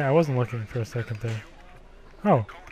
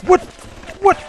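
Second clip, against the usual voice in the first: usual speaking voice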